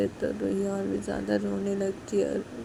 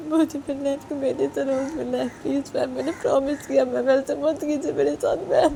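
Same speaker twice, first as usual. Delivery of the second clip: higher, pleading through tears; high desperate pleading woman; tearful begging; 9 semitones higher than usual. Acted. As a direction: beg her not to stop the visits. Ruhi begging on the phone.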